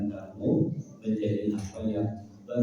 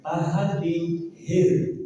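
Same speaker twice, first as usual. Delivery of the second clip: "hir" ditahan dan ditekan keras; tahan di "hir"; 5 semitones higher than usual. Shown as a demonstration. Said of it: "Yang benar". ("hir" ditekan tinggi dan keras)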